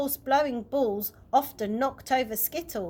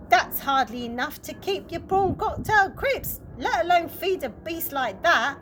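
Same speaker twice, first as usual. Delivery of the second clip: higher, louder scorn; scornful stepmother; 5 semitones higher, 4 dB louder than usual. A narrator's reading. Stepmother belittling dad's benefit money, scornful. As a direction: belittle him.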